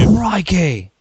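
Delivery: loud Crocodile Hunter crikey; Australian accent. Retold or imitated. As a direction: exclaim like a croc hunter. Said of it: "my Australian accent".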